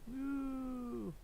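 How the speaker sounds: soft, high sustained "woo"; imitated whoosh. Streamer voicing the zoom animation, soft imitated whoosh. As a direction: make a high, quiet whooshing noise.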